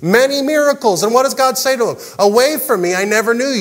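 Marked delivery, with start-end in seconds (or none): 2.2-3.6 raised, forceful dismissal; stern rejection. Acted. God turning away those who boasted of miracles, stern and raised. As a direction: send them away in a higher, firm voice. God's rejection voiced, first telling.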